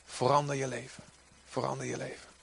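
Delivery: soft, pleading repeat; soft plea. Acted. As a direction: drop to a quiet urgent plea. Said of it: "Jeremia zei". (zacht smekend)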